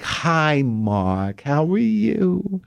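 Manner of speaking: put-on slow, sweet old lady hello; sweet old neighbor. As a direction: greet him like a doting elderly neighbor. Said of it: "she's like".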